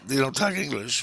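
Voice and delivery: retelling a baffled question back, disbelieving; incredulous question. Retold or imitated. Dreamer questions the munchkins' handler, incredulous. Echoes what he was just told.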